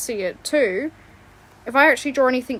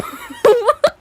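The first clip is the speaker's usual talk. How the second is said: high squealing laugh; 12 semitones higher, 10 dB louder than usual. Real, not acted.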